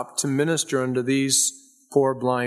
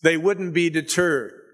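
usual speaking voice

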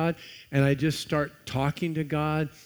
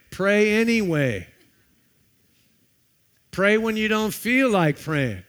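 louder, higher, insistent preaching; 5 semitones higher, 7 dB louder than usual. Acted. Pastor urging the church to pray, preaching hard. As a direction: drive it home to the congregation.

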